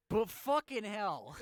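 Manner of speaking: loud, high, slow exclamation through laughter; laughing outburst. Real, not acted. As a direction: burst out, losing it laughing. Still cracking up at own slip.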